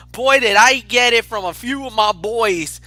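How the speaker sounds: ordinary talk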